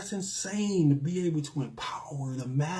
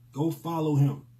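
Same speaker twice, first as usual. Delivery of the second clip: usual speaking voice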